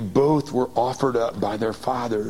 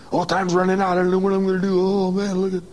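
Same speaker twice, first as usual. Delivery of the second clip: hammed-up panicked voice, pitched up and loud; mock fretting worrier; 8 semitones higher, 4 dB louder, faster than usual. Preacher mimicking a worried man running out of time, mock panic. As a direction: play the anxious man checking his watch.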